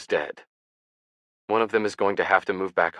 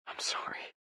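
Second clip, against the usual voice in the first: whispered, softer, near tears; tearful whisper; 11 dB quieter than usual. A narrator's reading. Ian apologizing to Ben, tearful whisper. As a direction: barely get the apology out. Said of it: "his voice tearful".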